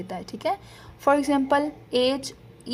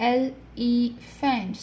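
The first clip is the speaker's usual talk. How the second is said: syllables spelled out slowly; much slower than usual. Shown as a demonstration. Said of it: "this is a three syllable word".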